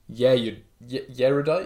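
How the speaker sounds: yerudite with a "y" sound in front, rising; mispronounced yerudite. Retold or imitated. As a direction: put a "y" before erudite. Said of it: "And one guy says".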